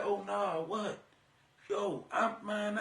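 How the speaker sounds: soft, drawn-in reaction to juicy gossip; quietly hooked on the rumor; hushed intrigued reply. Retold or imitated. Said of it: "And you be like".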